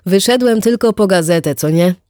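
a casual man tossing off an excuse; chatty neighbour voice. Acted. Wit to Erika, brushing off a walk, lightly voiced chatty neighbour.

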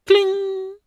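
very high, loud chime; piercing high imitated ding; strange ringing kling. A narrator's reading. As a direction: ring it out like a struck bell. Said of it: "ertönte ein seltsames".

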